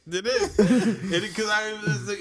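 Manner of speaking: laughing while talking. Real, not acted. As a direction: talk through laughter. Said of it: "laughing while defending".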